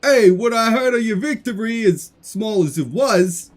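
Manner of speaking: loud, high, taunting put-on voice; brash sea god. Poseidon mocks his brother's small victory, loud and brash. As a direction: boom out a mocking greeting to a rival.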